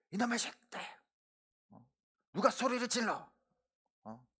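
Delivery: high-pitched re-enacted scolding, held back in volume; angry scolding. Father telling off his fighting sons, re-enacted angry scolding. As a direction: act out a dad's angry outburst. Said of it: "그러면서 화를 냈어요".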